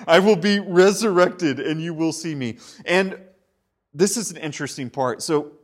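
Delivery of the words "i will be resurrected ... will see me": words said through a laugh; laughing paraphrase. Acted. Preacher laughing while paraphrasing Jesus's promise. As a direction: let the laugh carry into it.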